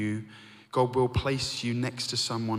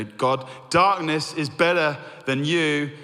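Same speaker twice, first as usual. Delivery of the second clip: bitter lament; 4 semitones higher, 5 dB louder than usual. Retold or imitated. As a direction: hurl it at God, wounded and bitter. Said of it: "You know".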